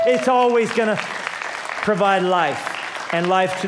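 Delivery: rising from firm to loud, slowing at the end; building preached promise. Acted. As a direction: build to a ringing climax.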